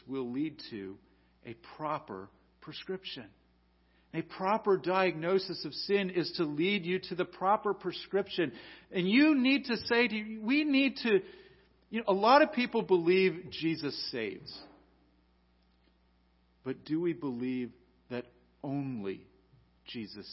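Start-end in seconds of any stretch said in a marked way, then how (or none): 6.1-14.5 high, loud exhortation; fervent preaching. Acted. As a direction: press the point home to the church. Pastor exhorting the congregation.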